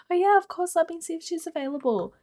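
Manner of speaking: very high, sugary receptionist pitch; put-on high-pitched customer service cheer; fake politeness. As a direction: oblige a rude caller with exaggerated sweetness. Fake politeness to a rude caller.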